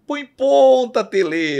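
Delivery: fanatical fan yelling high and loud; Zé da Galera. Retold or imitated. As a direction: holler it like a football fan. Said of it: "Lembra aquele torcedor fanático?". (torcedor fanático gritando agudo)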